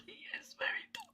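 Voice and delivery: very soft, quick whisper; whispered aside. Acted. Streamer confiding that the client is dumb, quick whisper. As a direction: whisper it fast, like a secret.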